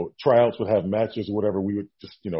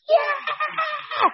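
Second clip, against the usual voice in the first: very high celebratory squeal; inner excited yell. Retold or imitated. Rookie silently celebrating the offer inside, excited yell. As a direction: let out a huge high whoop of yes. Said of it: "I'm like, inside".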